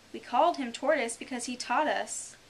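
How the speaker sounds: loud, mildly angry retort. Acted. The Mock Turtle answering Alice's question, angry. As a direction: snap back, moderately cross. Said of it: "said the Mock Turtle angrily".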